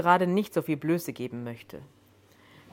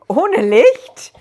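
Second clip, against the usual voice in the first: startled question shot up much higher and much louder; too high pitched; 9 semitones higher, 14 dB louder than usual. A narrator's reading. Joan, frightened by the stranger, asks in a shrill high pitch. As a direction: yelp it high and loud, still shaken. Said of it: "in immer noch viel zu hohem Ton".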